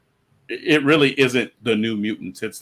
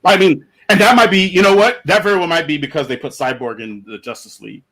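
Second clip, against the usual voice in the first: ordinary talk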